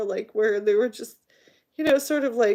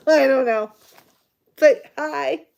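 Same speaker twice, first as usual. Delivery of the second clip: suddenly loud and high; 5 semitones higher, 9 dB louder than usual. Real, not acted. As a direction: burst out a greeting. Flustered loud greeting.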